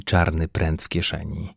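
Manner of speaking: ordinary delivery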